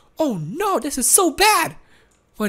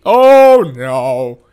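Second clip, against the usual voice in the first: startled oh; 13 semitones lower, 8 dB louder than usual. Real, not acted. Sudden danger.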